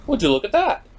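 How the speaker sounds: ordinary talk, nothing marked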